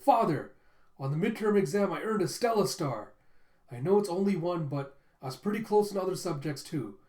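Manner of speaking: acted kid desperate for praise; eager blurting. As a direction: burst out with news to impress father. Son blurting achievements to father.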